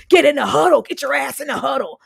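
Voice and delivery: loud order, fading on the repeat; mock coach yell. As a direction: bark it at the team, then let it drop. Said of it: "That was too much".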